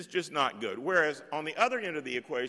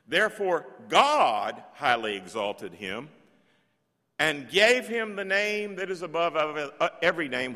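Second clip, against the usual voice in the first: solemn scripture reading; 2 semitones higher, 5 dB louder than usual. Acted. Reading scripture aloud, loud.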